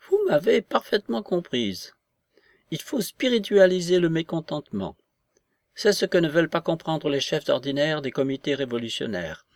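high, emphatic voice of an agitated grande dame; excitable society lady. Acted. Voices Madame de S in dialogue.

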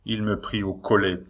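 ordinary delivery

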